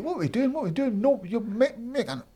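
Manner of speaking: high-pitched retold protest; comic high-voiced complaint; exasperated pleading. Pleading with agent over Rangers decor.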